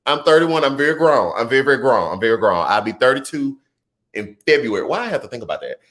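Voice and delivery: usual speaking voice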